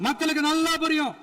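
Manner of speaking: high-pitched, insistent assembly oratory; sustained high, emphatic rebuttal. Real, not acted. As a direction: pitch it up high so the whole chamber hears. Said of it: "நான் ஏன் கொஞ்சம் சவுண்டா பேசுறேன்".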